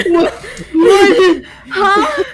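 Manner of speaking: baffled laughing what; puzzled, laughing; confused. Acted. Confused character reacting.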